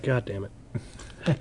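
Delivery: muttered curse. Retold or imitated. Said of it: "It's like".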